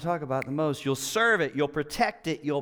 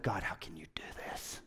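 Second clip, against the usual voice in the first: a hushed whisper of despair, much softer; whispered complaint; 9 semitones lower, 13 dB quieter than usual. Retold or imitated. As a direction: whisper it to God, devastated. Complaining to God about rain.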